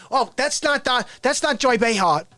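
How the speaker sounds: rough guess at a New York talk-show host; Joy Behar. Acted. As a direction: protest in a put-on New York voice. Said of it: "she kinda talks like that".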